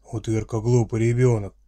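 loud gruff orc growl; friendly monster voice. Acted. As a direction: growl it like a stern orc dad. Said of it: "прорычал папашка".

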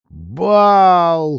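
loud, high, long-held cry; gleeful drawn-out exclamation; rapturous drawl. A narrator's reading. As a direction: stretch the word out in delight. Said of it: "хором протянули девушки".

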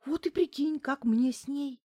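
high, plaintive lament; sighing complaint. A narrator's reading. Client lamenting life with the giantess, sighing. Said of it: "вздохнула Неделькина".

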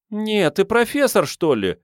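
put-on high-pitched girlish disbelief; cheeky astonished challenge; surprised girl. A narrator's reading. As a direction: start very high, drop at the end. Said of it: "удивилась Мурзик".

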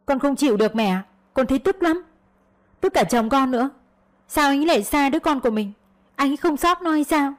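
high-pitched, indignant complaint; upset venting. A narrator's reading. Daughter complaining to her mother about husband, indignant.

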